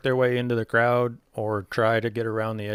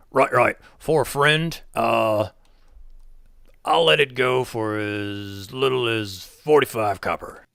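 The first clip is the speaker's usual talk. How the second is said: louder goblin giving in, slower at the end; goblin merchant; 6 dB louder than usual. Acted. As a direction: grudgingly drop the price, goblin-style.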